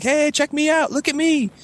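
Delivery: high-pitched call for attention; showing off. Retold or imitated. Teen showing off to parents.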